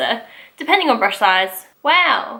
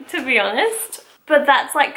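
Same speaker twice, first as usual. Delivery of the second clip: ordinary talk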